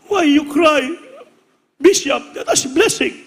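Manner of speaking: amused disbelief, laughing at the tears; laughing surprise. Retold or imitated. American asks why pastor cries.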